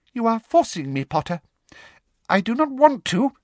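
high-pitched, self-justifying official; reluctant menacing official. A narrator's reading. Umbridge talks herself into punishing Potter, high-pitched. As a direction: pitch it high, working up to a threat. Umbridge figure voiced by narrator.

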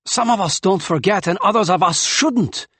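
loud, high bitter retort; glaring. A narrator's reading. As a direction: snap it with a glare. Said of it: "he glared at Takyan".

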